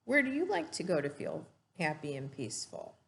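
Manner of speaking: normal talking voice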